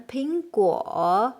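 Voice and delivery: 苹果 said slowly with guo on a dipping third tone. Shown as a demonstration. Teacher contrasting 苹果 with 德国, slow demo. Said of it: "it's a third tone".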